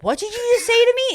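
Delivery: high-pitched affronted retort; mock indignant challenge. Confronting a friend who swore, high and indignant. Retold quarrel, own indignant comeback.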